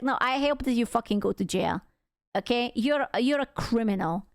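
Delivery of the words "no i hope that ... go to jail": ordinary delivery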